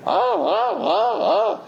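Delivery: high-pitched mock laughing cries; hammed-up "ah, ah" of helpless laughing; imitated audience laughter. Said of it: "and they're laughing".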